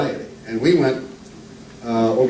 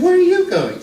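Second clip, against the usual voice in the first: surprised; 4 dB louder than usual. Acted. Husband surprised at dressed-up wife.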